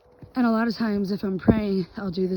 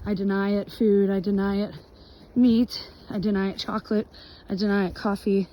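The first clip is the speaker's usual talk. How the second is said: ordinary talk, nothing marked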